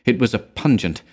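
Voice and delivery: ordinary delivery, nothing marked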